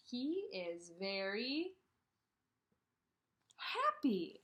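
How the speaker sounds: slow reread. Shown as a demonstration. Rereads text to class.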